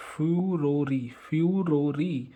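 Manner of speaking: drawn-out फ्यूरोरी with long falling syllables; slow demonstration. Shown as a demonstration. Teacher drilling the trick word, slow and drawn-out. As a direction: stretch each part of फ्यूरोरी. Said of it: "इसको हम पढ़ेंगे फ्यूरोरी".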